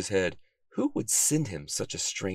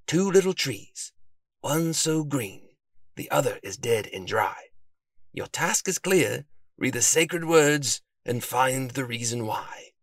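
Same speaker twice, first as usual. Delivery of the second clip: slow, higher-pitched riddle reciting; mysterious recitation; 5 semitones higher, slower than usual. A narrator's reading. Detective recites the note to his friend, slow and mysterious. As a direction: draw out each line of the verse.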